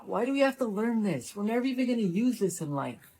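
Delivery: bored student. Acted.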